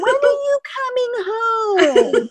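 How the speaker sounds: put-on high, slow, laughing old-lady plea; imitating grandma. Grandma asking a grandchild about coming home, high slow voice. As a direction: ask it like a wistful old lady. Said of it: "And she's like".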